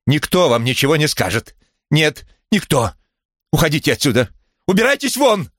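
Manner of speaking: high-pitched angry cries, climbing to the last order; furious outburst. A narrator's reading. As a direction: lose control and order them out, voice rising sharply. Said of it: "вдруг сорвалась с места, вся дрожа". (гневный срыв на высокий крик)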